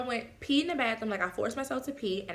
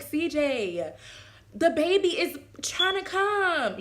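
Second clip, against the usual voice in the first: high, slow, urgent call; urgent plea; 8 semitones higher, 4 dB louder, much slower than usual. Retold or imitated. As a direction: beg him to hurry up. Urging partner to hurry.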